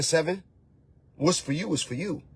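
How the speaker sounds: usual speaking voice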